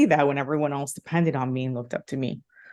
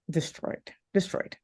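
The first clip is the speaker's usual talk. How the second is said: hushed; 7 dB quieter, slower than usual. Real, not acted. Grief after the friend's death.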